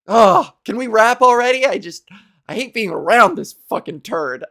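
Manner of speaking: loud, laughing fake praise turning to disgust; sarcastic crew. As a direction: fake it, then groan. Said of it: "They're like".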